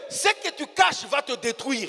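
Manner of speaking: slow sermon warning. Acted. Preacher warns the congregation slowly that hidden things destroy. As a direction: lay each word down like a verdict.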